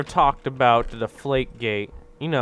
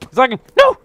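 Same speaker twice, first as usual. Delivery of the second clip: rising frustration; 10 semitones higher, 10 dB louder, faster than usual. Real, not acted.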